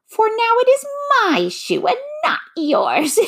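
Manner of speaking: very high cackling witch voice; gloating witch. A narrator's reading. The witch claims Dorothy's shoe, gloating shrilly.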